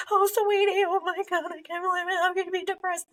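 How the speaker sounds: high, hammed-up panicky mom; mock a parent who makes it about herself; overreacting parent. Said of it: "even, like, you know".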